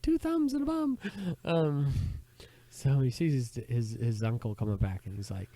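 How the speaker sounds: ordinary talk, nothing marked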